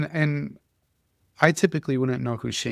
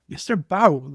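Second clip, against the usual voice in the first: higher, louder call to the teacher; mock kids calling out; 6 semitones higher, 4 dB louder than usual. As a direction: call him out like a rowdy kid. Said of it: "first they yell at me".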